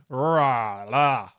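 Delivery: "Rah, la" as a loud R drill; exaggerated R. Shown as a demonstration. Streamer drilling the R's for Danish learners, exaggerated R. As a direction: growl into a long R on "Rah", then "la". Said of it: "learn, learn the R's".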